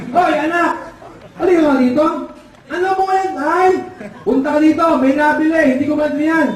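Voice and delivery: high, agitated calling-out; baffled dad calling for help; father calling son. Acted. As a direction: summon your son from across the shop. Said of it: "tinawag yung anak ng ngongo".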